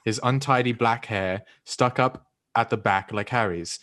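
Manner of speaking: put-on very proper, old-fashioned English accent reading a novel; overly proper English storybook accent. Shown as a demonstration. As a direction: read aloud like a proper Englishman. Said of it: "when I do an, an English accent".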